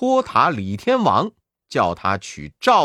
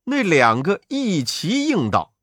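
ordinary delivery

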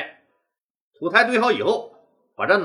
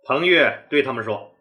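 plain reading voice

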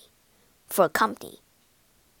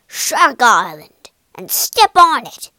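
pirate captain command; 8 dB louder than usual. Acted. Captain barks order.

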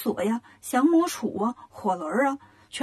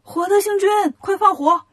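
high, loud battle call; raised, high-pitched yell; urgent shout; 8 semitones higher, 5 dB louder than usual. A narrator's reading. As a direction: shout the command urgently to an ally. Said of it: "悟空赶紧喊".

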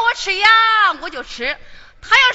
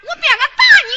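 higher, louder threat; threatening shout; 8 semitones higher, 6 dB louder, faster than usual. Acted. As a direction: yell it at him to scare him. Maid threatens young master.

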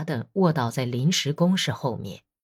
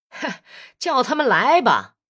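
defiant; 7 semitones higher, 4 dB louder than usual. Acted. Soldier defiant before the battle.

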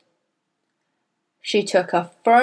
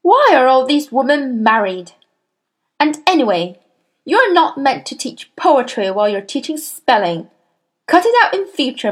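louder, higher stern scolding; the Trunchbull scolding; 5 semitones higher, 5 dB louder than usual. A narrator's reading. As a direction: scold the teacher before the class. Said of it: "snorted the Trunchbull".

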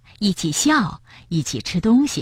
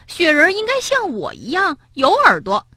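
much higher, insistent claim; insistent rabbit; 11 semitones higher than usual. Acted. Rabbit insisting the snowman should look like him. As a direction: push your point hard.

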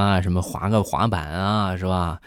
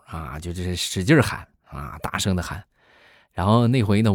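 ordinary talk

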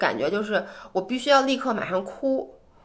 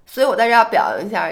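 normal talking voice

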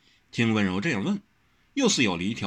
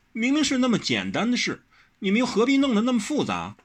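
much higher, sure of herself; self-righteous; 13 semitones higher than usual. A narrator's reading. Wen Rou scolding everyone, self-assured and higher. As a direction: state it as if obvious. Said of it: "理直气壮地说".

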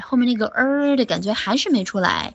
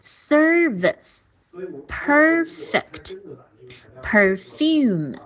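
very slow "Service", "perfect", "perfume", stressed syllables stretched; SER-vice, PER-fect, per-FUME, one by one; high, deliberate word-by-word model; syllable stress drill; 4 semitones higher, much slower than usual. Shown as a demonstration. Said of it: "一边儿来练习一下这些单词".

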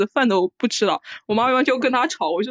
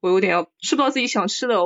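ordinary delivery

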